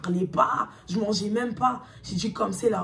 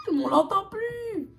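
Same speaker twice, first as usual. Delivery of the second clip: high, slow mocking line; 12 semitones higher, 4 dB louder, much slower than usual. Mocking ex gone quiet. (ton moqueur, aigu et lent)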